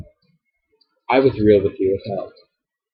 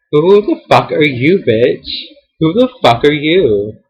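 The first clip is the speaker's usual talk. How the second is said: furious yelled question, louder and higher; angry outburst; 5 semitones higher, 6 dB louder, slower than usual. Real, not acted. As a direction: shout it at her, outraged. Angry rant at former friend.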